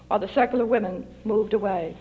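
usual speaking voice

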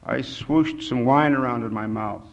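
usual speaking voice

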